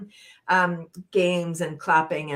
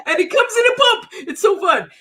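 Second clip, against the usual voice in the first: much higher, louder, laughing through it; laughing excitedly; 9 dB louder than usual. Shown as a demonstration. Leader selling pretend laughter cream, laughing high. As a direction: bubble over with laughter. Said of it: "Laughter Cream".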